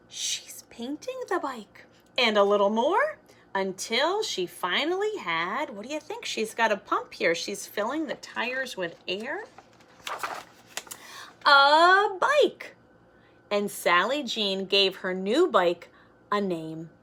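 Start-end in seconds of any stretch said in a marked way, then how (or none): 0.1-1.9 breathy secret aside; hushed excited aside; whisper. Real, not acted. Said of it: "whispered aside to listeners". 11.5-12.8 loud, high cry of joy; big excited reveal; triumphant reveal. Acted.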